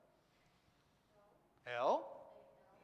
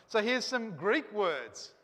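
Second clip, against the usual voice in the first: slow and louder, drawn out; slow it down to introduce the words; teacher introducing Greek words to class; slow announcement; 3 semitones higher, 6 dB louder, much slower than usual. Shown as a demonstration.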